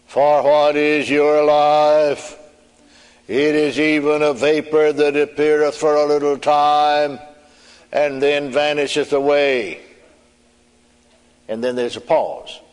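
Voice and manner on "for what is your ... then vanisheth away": heavenly voice. Retold or imitated. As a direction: intone it slowly like a voice from heaven. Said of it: "it sounded about like this".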